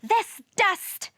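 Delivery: high, exasperated splutter. A narrator's reading. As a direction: splutter as dust tickles the nose. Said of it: "spluttered Venus".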